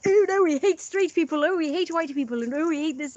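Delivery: high, loud mocking whine; shrill mock outrage lifted high; mock hater. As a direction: parody someone sneering at straight people. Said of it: "the next minute you're all like".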